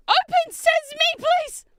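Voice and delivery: very high, loud command; shrieked, exasperated plea; desperate shout. Real, not acted. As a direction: yell the magic words at a stuck box. Shouts at the stuck box.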